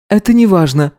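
high sharp brush-off; curt cutoff. A narrator's reading. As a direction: cut him off sharply. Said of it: "отрезала она".

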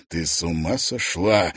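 low, tight angry hiss; husband hissing at his wife; furious hiss. A narrator's reading. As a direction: hiss it at her through the teeth. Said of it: "яростно прошипел он".

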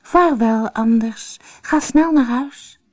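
high, urgent fairy queen's call. A narrator's reading. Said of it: "Toen riep de veeënkoningin". (met hoge stem roepen)

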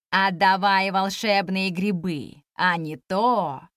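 low, slow threatening demand; menacing fox. A narrator's reading.

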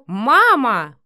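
loud, sharp cut-in; rough interruption. A narrator's reading. Son stopping his mother's insults, roughly. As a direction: cut her off roughly and loudly. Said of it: "оборвал её грубо Матвей".

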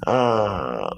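low inhaled "uh". Shown as a demonstration. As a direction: draw the breath in on a low voiced tone. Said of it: "lower the tone".

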